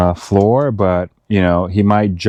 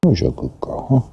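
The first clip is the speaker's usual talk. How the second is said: squeaky praising a dog; 6 semitones higher, faster than usual. Real, not acted. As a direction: coo at the dog like a pet.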